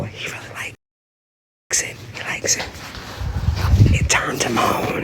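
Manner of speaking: hushed stage whisper to the audience; confiding whisper. Pretend. Wife telling viewers what her husband likes, confiding whisper. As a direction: whisper it like a juicy secret.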